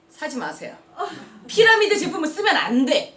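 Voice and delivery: angry refusal. Retold or imitated. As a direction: bite back, refusing the sale.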